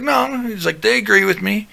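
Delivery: high-pitched mock insistence; insistent. Dutch defending himself to his friend, insistent. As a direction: imitate a stubborn pal, pitched well up.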